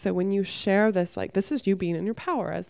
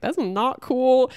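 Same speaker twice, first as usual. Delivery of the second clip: firm disapproval; 6 semitones higher, 4 dB louder, much slower than usual. Retold or imitated. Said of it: "she was like".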